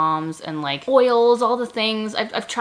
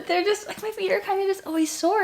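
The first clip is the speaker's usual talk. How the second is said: high whiny voice; 12 semitones higher than usual. Real, not acted. Complaining about sore feet.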